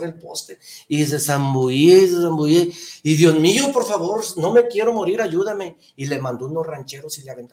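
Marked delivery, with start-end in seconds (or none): 3.1-5.8 higher, louder desperate cry; desperate plea. Retold or imitated. Drowning man begs God to save him, higher and louder. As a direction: beg for rescue while going under.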